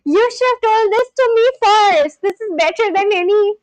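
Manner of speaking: high, loud, thrilled complaint; excited protest. Real, not acted. Streamer scolding that the dessert was kept from them, excited protest. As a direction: protest loudly, carried away with delight.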